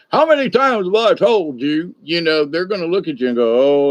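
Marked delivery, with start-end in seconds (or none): 0.1-1.9 higher, exasperated reproach; lightly acted nagging; nagging scold. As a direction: scold like a fed-up parent. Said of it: "which if I'm like".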